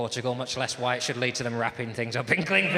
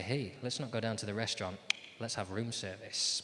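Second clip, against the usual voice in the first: quiet suggestive; 3 semitones lower, 8 dB quieter than usual. Retold or imitated. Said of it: "I say".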